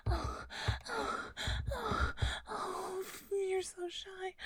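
high, loud, breathless moaning; building to a loud climax; climax moans. Acted. Second climax in erotic roleplay.

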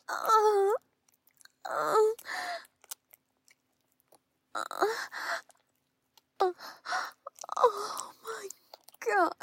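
high, loud pleasure cries; loud moaning. Acted.

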